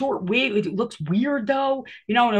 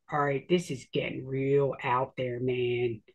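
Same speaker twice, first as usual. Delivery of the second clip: low, hushed uneasy aside; spooked by the coincidences; 9 semitones lower, 6 dB quieter than usual. Retold or imitated. Reacting to eerie coincidence.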